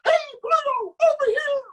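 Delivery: squeaky falsetto cartoon mouse calling out; Mickey Mouse impression. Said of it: "you know, Mickey Mouse".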